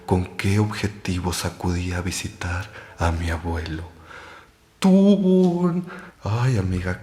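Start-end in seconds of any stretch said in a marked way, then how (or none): 4.8-5.8 high, loud spooky "tun" sting; dramatic sting. Pretend. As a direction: belt out a horror sound effect. Dramatic sting after scary tale.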